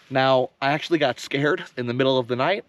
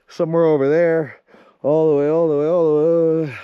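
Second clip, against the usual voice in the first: panting between words; breathless from the climb; out of breath; 4 dB louder than usual. Real, not acted. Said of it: "I can't even talk".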